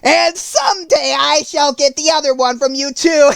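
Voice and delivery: high, loud threat ending in a long cackle; shrieked witch taunt and cackle; gloating witch with cackle. A narrator's reading. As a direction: taunt as a wicked witch, then laugh wickedly. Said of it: "laughing at her".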